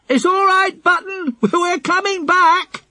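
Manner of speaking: high, loud reassuring call. A narrator's reading. Philip to the dog left below, calling out. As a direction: call down in a high voice, stretching the last words.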